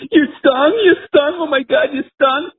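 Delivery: hammed-up, high-pitched panicked wail; mortified wife. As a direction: imitate a horrified wife crying out in a squeal. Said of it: "just mortified".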